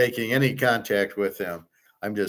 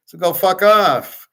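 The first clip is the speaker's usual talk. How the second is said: louder, higher fuck-off; angry dismissal; 6 semitones higher, 5 dB louder than usual. Real, not acted. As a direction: snap it at the rude man. Angry at rude locker man.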